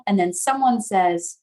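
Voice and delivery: usual speaking voice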